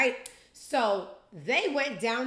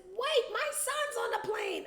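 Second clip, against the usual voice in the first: high pleading parody of a nervous mom. Retold or imitated. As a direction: squeak it out like a clutching lady.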